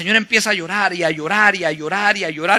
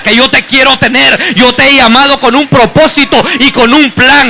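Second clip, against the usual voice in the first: fervent preaching building to a loud shout; predicación a toda voz; 3 semitones higher, 9 dB louder than usual. Acted. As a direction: proclaim God's promise, growing louder. Preaches, then voices God's promise. (predicación ferviente que sube hasta el grito)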